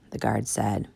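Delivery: ordinary delivery, nothing marked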